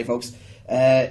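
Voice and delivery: ordinary talk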